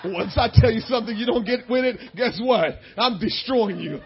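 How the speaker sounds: usual speaking voice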